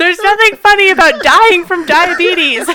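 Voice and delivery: cracking up while protesting; laughing. Real, not acted. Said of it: "You can't say it like that".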